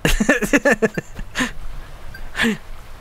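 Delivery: real laugh, drawn out. Real, not acted. Gamer amused by his own funny visual joke, laughing. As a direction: crack up at your own joke.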